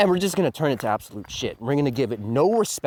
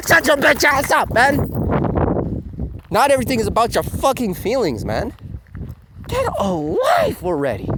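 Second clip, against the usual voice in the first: higher, louder mock snap at the fan; annoyed retort; 11 semitones higher, 4 dB louder than usual. Real, not acted. Reviewer telling off the crying fan, loud and higher. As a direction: tell the fan off, half joking.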